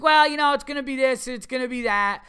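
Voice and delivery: snooty vet voice, hammed-up and high; mocking the vet. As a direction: parody the vet brushing off the price, high and loud. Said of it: "It's like".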